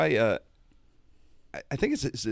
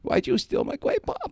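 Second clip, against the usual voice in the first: much higher, squeaky cartoon Fudd accusation; Elmer Fudd voice. Kicker confronting Jimmy again, high squeaky Fudd voice. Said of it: "Like that".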